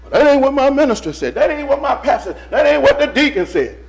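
lightly voiced whiny excuse; mocking a stubborn churchgoer. Preacher mocking members who cite their minister, in light mimicry. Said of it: "The Lord will".

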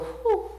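high amazed whew. Acted. Preacher imagining arriving in heaven, high whew. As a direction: let out a high breathless whew. Overwhelmed exhale.

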